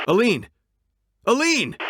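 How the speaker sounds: loud, high desperate call. Acted. Partner calling out for Aline, afraid she's gone. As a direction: shout her name into the dark.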